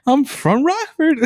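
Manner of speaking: high, slow teasing voice; sing-song it up high and slow; mock reveal. Teasing a friend with a high, slow mock reveal. Mocking friend's hometown claim.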